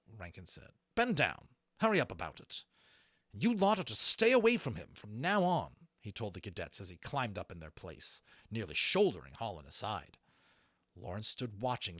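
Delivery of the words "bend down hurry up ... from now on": loud, clipped commands; curt orders. A narrator's reading. Rankin ordering the cadets away, loud and harsh.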